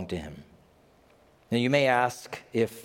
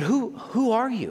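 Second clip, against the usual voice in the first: startled question; 11 semitones higher than usual. Retold or imitated. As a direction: jump up in alarm. Startled Hopkins character.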